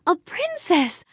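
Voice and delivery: gasp, then high, slow amazement; delighted surprise. Acted. Heroine suddenly meeting a princess, gasping with delighted surprise. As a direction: gasp and exclaim high in wonder. Character meets a princess, gasps.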